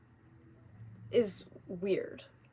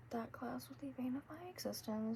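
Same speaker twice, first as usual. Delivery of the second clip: whisper; 3 semitones higher, 13 dB quieter than usual. Real, not acted. Whispered aside about hated class.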